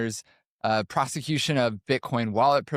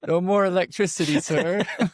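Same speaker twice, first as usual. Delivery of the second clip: higher, louder, hammed-up presidential voice; Trump impression; 7 semitones higher, 3 dB louder than usual. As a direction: ham up the president begging line. Mocking Trump clip just played.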